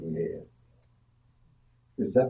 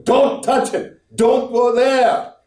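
shouting from heaven; 13 semitones higher, slower than usual. Acted. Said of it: "wish God would shout from heaven".

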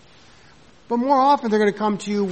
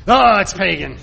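louder, a self-righteous little blurt; mock blurted accusation; 8 dB louder than usual. New member blurting to a church friend, mock accusing. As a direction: toss it off like a know-it-all newcomer. Mocking a newcomer calling things pagan.

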